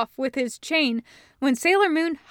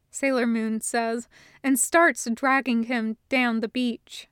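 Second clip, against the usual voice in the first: ordinary delivery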